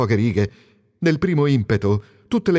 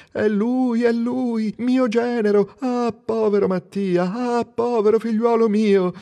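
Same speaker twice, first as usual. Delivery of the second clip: high, far-off wailing mother-in-law; high keening voice of a grieving widow; widow's lament; 8 semitones higher, slower than usual. Retold or imitated. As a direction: mimic the widow crying over her son-in-law. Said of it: "Ma sarà stata lei, forse lei, Marianna Dondi".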